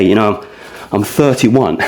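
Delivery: ordinary talk, nothing marked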